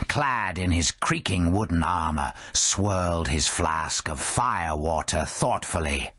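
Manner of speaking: plain reading voice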